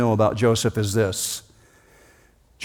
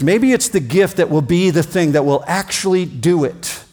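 usual speaking voice

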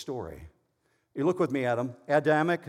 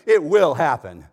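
much louder, firm pronouncement; emphatic declaration; 14 dB louder than usual. Acted. Preacher voicing God's unconditional promise, loud and emphatic. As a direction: declare it loudly, as a sure promise. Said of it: "God's saying".

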